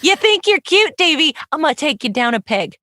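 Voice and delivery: hammed-up playground bully taunt; mock tough guy. Mock threat to Davey.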